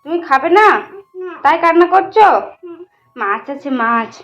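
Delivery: high, loud sing-song coaxing. Real, not acted. Mother coaxing her crying son to eat rice, high loud sing-song. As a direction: lift the voice way up and coax the little one to eat.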